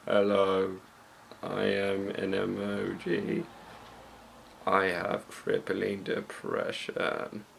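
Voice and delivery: mock depressed emoji, low and quiet. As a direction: mumble it like a hopeless little emoji. Voicing a sad emoji teaser.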